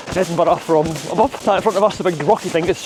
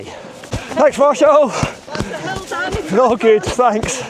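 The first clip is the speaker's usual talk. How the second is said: calling out thanks; 9 semitones higher, much slower than usual. Real, not acted. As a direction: shout your thanks over to him. Calling thanks to marshal running past.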